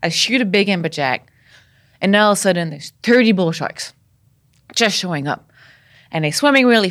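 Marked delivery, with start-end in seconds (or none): none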